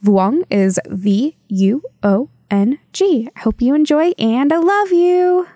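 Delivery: ordinary talk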